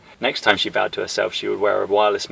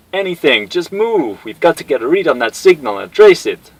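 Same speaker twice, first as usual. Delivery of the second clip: high loud urgent shout; 7 semitones higher, 10 dB louder than usual. Acted. Guile urging Cammy to trace the signal, loud urgent shout. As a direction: push her to move now.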